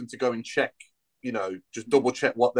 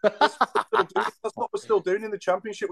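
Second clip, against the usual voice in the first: laughing; 8 semitones higher than usual. Real, not acted. Laughing at his own analogy.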